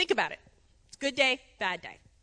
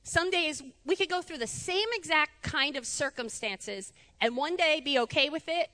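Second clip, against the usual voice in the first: usual speaking voice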